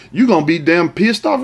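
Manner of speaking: ordinary delivery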